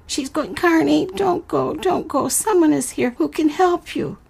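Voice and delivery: put-on high, urgent mother calling her girl back. Mother calling Carney back, high and urgent. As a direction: plead with her child not to slip away.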